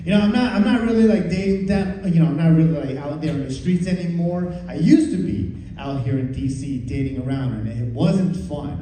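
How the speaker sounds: usual speaking voice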